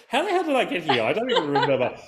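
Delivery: a puzzled commuter, played for laughs; laughing mock bewilderment. Voicing an autopilot commuter.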